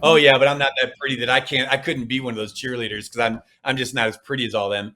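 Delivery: mildly sarcastic self-put-down. Acted. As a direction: toss it off low with light sarcasm. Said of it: "go ahead and say it sarcastically".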